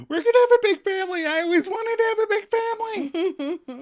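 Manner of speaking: very high, fast, bouncy squeal; Alice hugging the others, giddy. Retold or imitated. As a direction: gush it out, bouncing with joy. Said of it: "Alice is giddy ... as she bounces".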